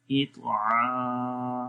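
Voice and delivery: "इतआ" very slow, final "आ" held long from the throat; long ain. Shown as a demonstration. Teacher models elongation for learners, "इतआ" with a long final ain. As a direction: sustain the "आ" of "इतआ". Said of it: "आइन को लंबा करेंगे".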